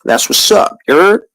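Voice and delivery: imitating young Brooklyn kids; light put-on New York street voice; modern New York slang.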